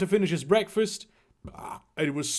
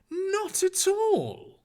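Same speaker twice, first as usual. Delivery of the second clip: high, slow, drawn-out answer; drawn-out denial; 12 semitones higher, much slower than usual. Acted. Reader answering an objection about accents, high drawn-out denial. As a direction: stretch it out high in protest. Mock answer to own question.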